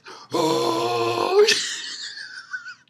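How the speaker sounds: long, high mock Super Saiyan cry; hammed-up high wail; mimicked power-up yell. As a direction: imitate an anime power-up with a drawn-out "oh". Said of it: "She's like this".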